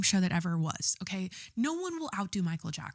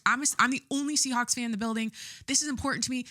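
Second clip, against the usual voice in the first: usual speaking voice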